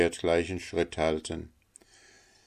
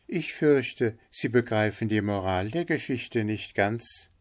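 polite linnet; 4 semitones higher than usual. Acted. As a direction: point it out politely, pitched high. Figure of the linnet. (höflicher Hänfling mit hoher Stimme)

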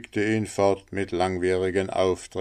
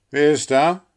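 doctor shouts from the window, loud and higher; loud, higher call from upstairs; 7 semitones higher, 8 dB louder than usual. A narrator's reading. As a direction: call down into the storm. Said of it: "rief der Arzt".

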